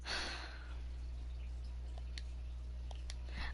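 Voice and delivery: long tired breath out; a quiet, drawn-out sigh of fatigue; weary sigh. Real, not acted. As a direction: let out a heavy sigh before you explain. Sighs before explaining to viewers.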